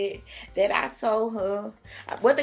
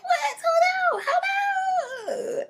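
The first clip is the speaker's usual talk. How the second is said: very high, crying shout of disbelief; shocked outcry; 3 dB louder, much slower than usual. Retold or imitated. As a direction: yelp it as the news hits you. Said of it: "I was shocked as hell".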